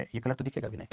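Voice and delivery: ordinary delivery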